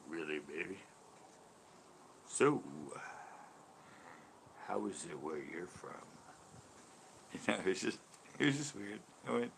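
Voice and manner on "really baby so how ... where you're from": low, soft seductive croon; a touch of a deep velvet soul voice. Pretend. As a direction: lower and softer, a smooth come-on.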